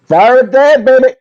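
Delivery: loud, high show-opening holler; hyped shout. Real, not acted. Dad kicking off the live show, hyped shout. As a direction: belt out the show name with gusto.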